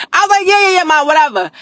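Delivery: laughing through the words. Real, not acted. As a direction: giggle through the retold line. Repeats while laughing.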